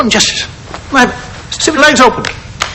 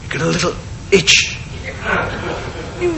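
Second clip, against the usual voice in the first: ordinary talk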